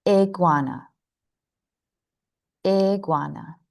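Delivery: "Iguana" beginning with a small, clear ih sound; clear short I. Shown as a demonstration. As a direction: say "Iguana" with a short I as in "sit" at the start. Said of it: "it helps to be more clear".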